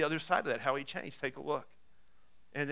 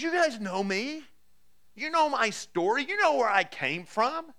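higher, slow and pressing, leaning on each "know"; impassioned appeal; 7 semitones higher, 5 dB louder, slower than usual. Acted. Paul to the Jerusalem crowd, an impassioned higher plea. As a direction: plead with them to remember who you were.

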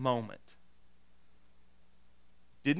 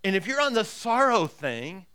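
louder, higher, driving sermon voice; full-force preaching; 6 semitones higher, 6 dB louder than usual. Acted. Pastor urging his congregation, in full-force preaching. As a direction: preach it to the back row with fire.

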